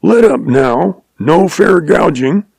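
slow, high protesting call from under a lion; drawn-out high yell of protest. Acted. Man pinned by lion calling for fair play, slow high yell. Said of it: "He remembered calling out".

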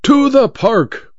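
mild haughty order to the driver; commanding order. A narrator's reading. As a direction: order it like a lordly showman. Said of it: "ordered Malvino".

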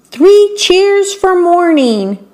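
high, slow and cheerful; cheery beetle voice. Acted. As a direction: read it like a happy little bug. Sign read in beetle voice.

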